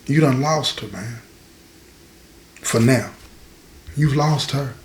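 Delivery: low, soft, measured delivery; subdued and low, letting it sink in; gentle hard truth. Retold or imitated. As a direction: break it to him quietly, with pauses. Breaking hard news to friend.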